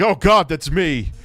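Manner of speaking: mock horror. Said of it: "you'd be just like".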